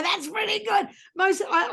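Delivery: usual speaking voice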